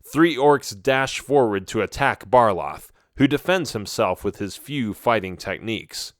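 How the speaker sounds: ordinary delivery, nothing marked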